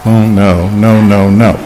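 low, subdued string of "no"; understated refusal. Shown as a demonstration. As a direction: drop every "no" down low, without force. Contrasting low take of the refusal.

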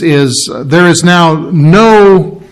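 is there is now no said slowly, leaning on no; slow preacher's no; slow stress on no. Shown as a demonstration. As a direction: drag out no for weight. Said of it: "preaching no condemnation".

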